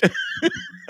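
high helpless giggle; breaking up laughing; burst of laughter. Real, not acted. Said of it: "I couldn't do it".

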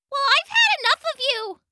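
very high, fast little bug voice; squeaky insect, fed up; tiny buzzing gnat voice. Acted.

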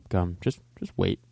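ordinary talk, nothing marked

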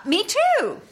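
high airhead line read. Pretend. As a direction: deliver it like a ditzy airhead. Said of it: "that got me that role".

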